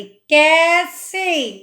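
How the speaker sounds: कैसे with a long open कै, very slow; drawn-out ai. Shown as a demonstration. Teacher stretching कै in कैसे. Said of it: "कै में दो मात्रा लग रही हैं".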